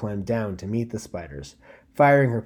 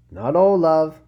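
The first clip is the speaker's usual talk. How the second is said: higher, louder first take, pressing the word all; tender reassurance; 8 semitones higher, 10 dB louder, slower than usual. Acted. Lyria comforting her friend before her sacrifice, first tender take.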